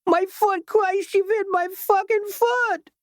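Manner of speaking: extremely high, drawn-out cry of agony; yell of pain. A narrator's reading. Shot picket yelling at Petrie in agony, extremely high drawn-out cry. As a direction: howl it out as if just shot in the foot. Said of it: "yelled in pain".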